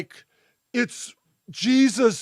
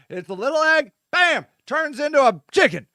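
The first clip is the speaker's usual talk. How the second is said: ordinary talk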